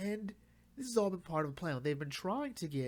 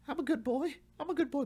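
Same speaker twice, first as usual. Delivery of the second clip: put-on much higher, whiny plea like a scared child; whimpering Bane; 12 semitones higher, 4 dB louder, faster than usual. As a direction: beg like a frightened little boy. Bane a quivering mass of tears.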